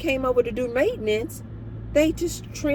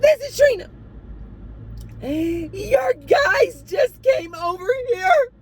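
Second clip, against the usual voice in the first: frantic crying imitation; 13 semitones higher, 4 dB louder than usual. Resident reporting the work crew by phone, frantic crying. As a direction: imitate a hysterical caller crying her complaint out. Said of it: "a woman crying frantic like this".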